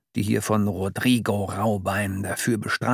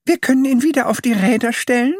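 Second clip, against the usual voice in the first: Mama Dick; 13 semitones higher, 5 dB louder, faster than usual. A narrator's reading. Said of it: "fragte sie dann".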